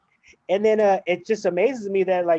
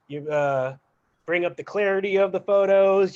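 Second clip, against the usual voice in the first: usual speaking voice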